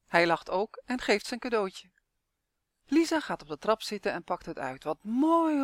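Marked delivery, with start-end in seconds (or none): 4.8-5.6 high, bright cry of joy over a gift; delighted exclaim. A narrator's reading. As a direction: gasp it out like a thrilled girl. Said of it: "roept ze".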